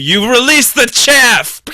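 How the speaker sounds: loud, high, drawn-out yell; mock battle cry. Riffing on driving as combat, dramatic yelled command.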